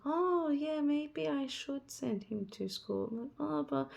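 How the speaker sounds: high, drawn-out wavering over a decision; mock self-doubt. Homeschooling mother second-guessing herself about school, high mock doubt. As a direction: act out second thoughts in a high, slow voice.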